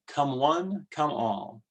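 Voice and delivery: ordinary delivery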